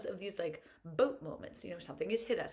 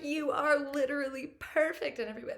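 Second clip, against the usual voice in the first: higher, louder enthusiastic reassurance; emphatic praise; 7 semitones higher, 7 dB louder than usual. Retold or imitated. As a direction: gush over a friend. Cheering on a friend.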